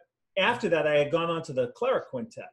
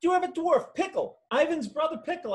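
exasperated high insistence; 13 semitones higher than usual. Retold or imitated. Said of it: "He said".

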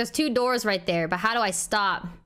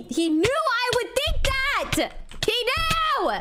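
excited outburst; 12 semitones higher, slower than usual. Real, not acted. Realizes the designer's trick.

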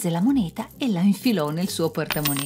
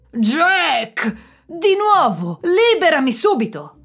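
much higher, louder furious roar; trapped devil roars to be freed; 10 semitones higher, 8 dB louder than usual. A narrator's reading. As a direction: bellow at Jack in rage. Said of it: "ruggì il diavolo".

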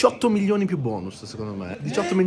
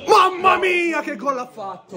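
high, loud amazed goal shout; excited shout; 11 semitones higher, 3 dB louder, slower than usual. Real, not acted. Reacting to goal.

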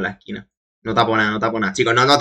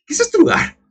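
put-on startled boy; 14 semitones higher than usual. Voicing Soma in dialogue.

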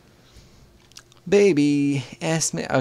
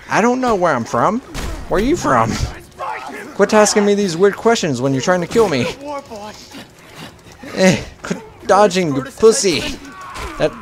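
yelling at enemies; 5 semitones higher than usual. Real, not acted. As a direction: shout back at them mid-fight. Yelling back at enemy NPCs.

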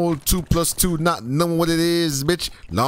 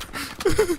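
short high giggle; real high chuckle; laughing. Real, not acted. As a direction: let out a quick high laugh. Laughs after escaping in game.

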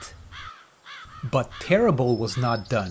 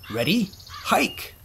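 football call; 5 semitones higher than usual. A narrator's reading. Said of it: "he imagined Farmer John said".

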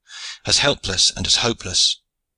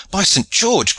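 high, alarmed exclamation; urgent high-pitched cry; Ford's cry; 11 semitones higher, 3 dB louder, slower than usual. A narrator's reading. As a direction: cry out in sharp dismay, pitch jumping up. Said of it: "cried Ford".

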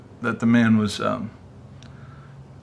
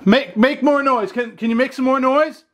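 urgent plea; 10 semitones higher than usual. Real, not acted. Calls out to the unseen noise.

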